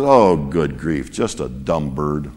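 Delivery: low, slow, dismissive grumble; a burglar brushing off the bird, voice dropping away; relieved burglar muttering. Acted. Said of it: "He says".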